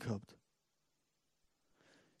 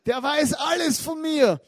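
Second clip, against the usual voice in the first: loud, high, slowed cry of amazement; hysterical; 10 semitones higher, 9 dB louder, slower than usual. Retold or imitated. Woman tells the townspeople, hysterically shouting her news. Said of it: "ganz hysterisch".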